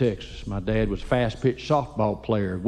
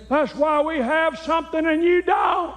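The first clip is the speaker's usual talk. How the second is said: high, put-on old dad voice, shouted; dad's voice. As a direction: fire back like a proud stingy father.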